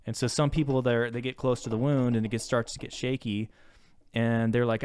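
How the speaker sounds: ordinary delivery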